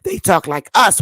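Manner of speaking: ordinary talk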